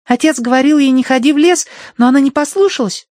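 put-on high, loud child voice; little girl voice. A narrator's reading. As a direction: speak like a demanding little girl.

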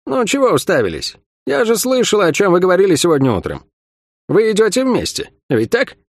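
understated huffy questioning; grumbling. A narrator's reading. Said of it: "Сойка буркнула".

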